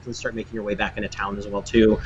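ordinary talk, nothing marked

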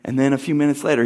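normal talking voice

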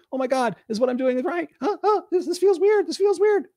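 high mock voice rising to a squeak; mock awkward panic. Mocks an awkward, unsure person.

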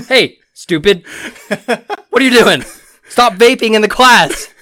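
loud, high mock yell at a student; hammed-up scolding shout; mock teacher yell. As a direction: bark it like an angry teacher. Teacher yelling at a vaping student.

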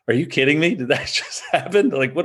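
half-laughing mock disbelief; retold laughing disbelief. Golfer laughs to his playing partner after a botched shot, imitated laughing disbelief. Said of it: "he started laughing too".

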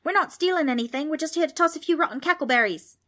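high, loud hurried protest; shouting. A narrator's reading. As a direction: shout your excuse. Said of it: "Darby shouted".

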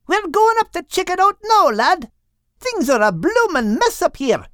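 put-on very high Scottish-accented bird voice; high Scottish lilt. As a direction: play Crinan calling down in a Scottish accent. Crinan bird voice.

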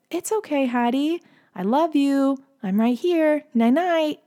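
put-on high, sweet little-girl comforting voice; Ellie. Big sister comforting screaming baby sister at bedtime, tender child voice. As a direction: imitate a small child soothing a sibling, pitched high. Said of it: "we hear Ellie say".

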